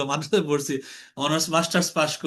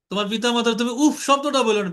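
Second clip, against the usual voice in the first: ordinary talk, nothing marked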